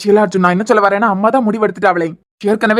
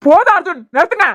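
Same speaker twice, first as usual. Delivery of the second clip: angry shout; 6 semitones higher than usual. Acted. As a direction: shout him down, furious. Varun shouts at Arjun to stop.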